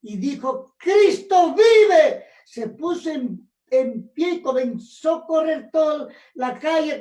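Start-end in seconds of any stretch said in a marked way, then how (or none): 0.8-2.3 'Cristo vive' cried out much louder and higher, very slow; shouted with force. Retold or imitated. Healed young man shouting to the whole street, loud cry. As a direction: belt out 'Cristo vive' with all your strength. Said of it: "pero con fuerza".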